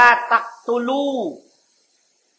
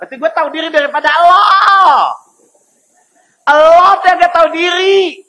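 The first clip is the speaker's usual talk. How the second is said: much higher, louder monkey retort, fast then held; monkey shouting back; 7 semitones higher, 8 dB louder than usual. Acted. Monkey shouting back at the angry man, mock loud retort. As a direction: build to a shrill-free loud comeback as the monkey. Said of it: "Apa kata monyet?".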